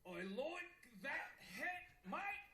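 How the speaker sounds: slow, loud, broad Australian, MATE shooting up; Australian accent attempt. Shown as a demonstration. Showing what he learned on stage, Australian accent attempt. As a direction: lay on a laboured Australian accent. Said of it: "here's what I've learned".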